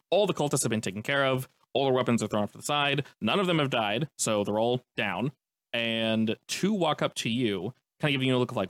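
normal talking voice

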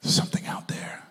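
mock eerie whisper; hushed mock-mysterious aside; spooky whisper. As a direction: whisper it like a campfire ghost story. Joking about aliens, spooky.

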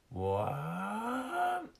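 long wail rising very high; wail, sliding up and holding it. Acted. A lost seal heard round the corner, high wail. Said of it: "The noise is louder than ever".

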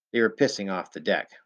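plain reading voice

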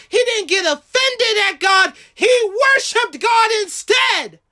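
high, emphatic sermon cry; impassioned preaching. Real, not acted. Preacher insists Job never blamed God, impassioned preaching. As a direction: drive each point home at a high pitch.

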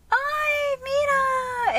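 high, loud, drawn-out squeal; gushing high-pitched exclamation; excited mom. Retold or imitated. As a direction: squeal with delight, imitating mom. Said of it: "she's all excited".

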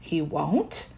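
soft, hushed question; a hushed, half-voiced he won't; quietly surprised whisper; whispered back. A narrator's reading. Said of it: "I whispered back".

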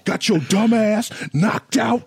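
high drawn-out taunt; slow mocking taunt. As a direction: rub it in slowly. Mocking the loser.

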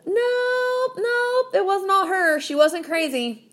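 drawn-out high-pitched "nope", easing down; mocking denial. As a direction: correct them in a high, teasing voice. Mockingly contradicting men bad-mouthing an ex.

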